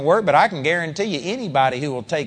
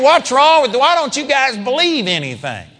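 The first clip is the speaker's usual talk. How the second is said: higher and louder, scolding; frustrated teacher; exasperated rebuke; 4 semitones higher, 3 dB louder than usual. Retold or imitated. Jesus scolding disciples continued.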